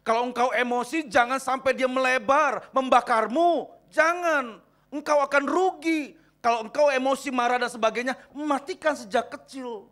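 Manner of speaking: loud, high-pitched exhortation to the congregation; full-force preaching. Real, not acted. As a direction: preach it loud, stretching the key words.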